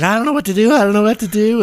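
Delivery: high, loud frantic nun; hammed-up helpless panic; panicked mock screaming. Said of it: "screaming their head off".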